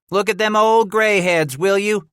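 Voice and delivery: put-on young greenhorn roaring jeers at old trappers; roaring taunt. A narrator's reading. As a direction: shout it out mocking the old men. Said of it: "one had roared".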